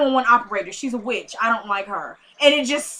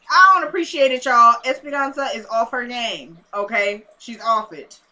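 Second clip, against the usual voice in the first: ordinary delivery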